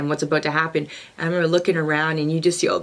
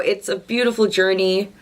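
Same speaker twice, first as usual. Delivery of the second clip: ordinary delivery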